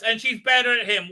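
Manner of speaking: ordinary delivery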